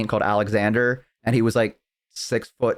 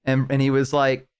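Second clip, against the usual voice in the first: ordinary talk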